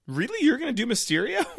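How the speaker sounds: high-pitched, quick incredulous question; mock disbelief. Fans second-guessing the villain announcement, high incredulous mock doubt. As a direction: imitate doubting fans, voice jumping way up. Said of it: "we all stopped back".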